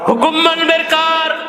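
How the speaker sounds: high chanted sermon cry; rally the faithful in a high, sustained call; rousing preaching. Real, not acted. (উঁচু সুরে উদ্দীপ্ত ওয়াজ)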